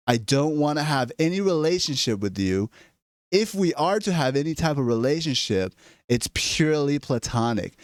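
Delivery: ordinary delivery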